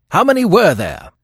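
high, loud urgent question; pressing interrogation; quick, urgent. A narrator's reading. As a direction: demand it eagerly. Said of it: "said the detective quickly".

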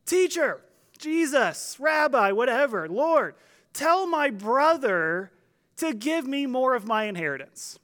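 mock yell from the back of a crowd; calling out. Man in the crowd interrupts Jesus from the back, calling out. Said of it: "yells out".

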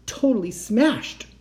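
slow, high, held-in anger; through gritted teeth. A narrator's reading. Girl showing her uncle the phone, teeth gritted. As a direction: force it out past clenched teeth. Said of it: "I said through gritted teeth".

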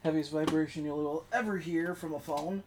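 distant from the microphone; speaking away from the mic; far from mic. Real, not acted. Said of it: "if I sound far away".

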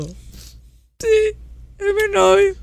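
high, excited proud claim; proudly gushing. Pretend. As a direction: show off a boyfriend with a giddy squeal. Coworker asks "is it your boyfriend?.

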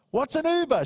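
mock the old woman's bewilderment; puzzled old lady. Homeless old lady hearing of Uber, retold with a high puzzled pitch.

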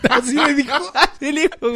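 very high laughter, words squeezed through; laughing hard. Real, not acted. Friend retelling the bus story laughs hard, very high.

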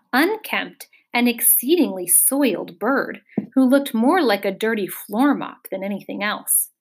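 ordinary delivery, nothing marked

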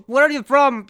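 loud, bubbling talk through water; sputtering, gurgled small talk; gurgling underwater. Acted. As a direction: talk with lake water lapping into your mouth. Acts talking with water at mouth.

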